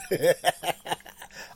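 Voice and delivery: a high, loud burst of laughter; laughing at himself. Real, not acted. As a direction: laugh it off, embarrassed. Laughs at losing his thought.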